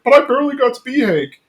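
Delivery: high, fast mock whine of protest; Bill. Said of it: "and he's like".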